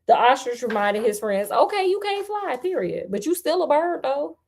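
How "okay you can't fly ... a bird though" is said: high, sassy reassurance; sassy aside. Real, not acted. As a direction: talk to him like a friend with attitude. Sassy commentary on ostrich.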